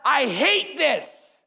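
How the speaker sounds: shouted high and loud, slow and raw; anguished shout to God. Retold or imitated. David shouting his frustration at God. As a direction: cry out in fury and despair. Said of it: "He was like".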